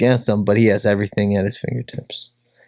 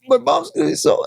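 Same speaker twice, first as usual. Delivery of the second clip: crying; 14 semitones higher, faster than usual. Retold or imitated. Crying while sharing story.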